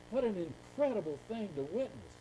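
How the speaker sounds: ordinary talk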